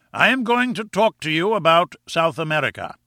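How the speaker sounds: lightly voiced imperious lecturer; pompous professor. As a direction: announce it like a professor to a class.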